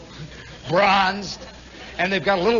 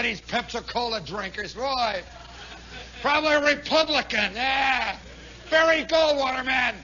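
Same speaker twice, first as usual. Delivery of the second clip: usual speaking voice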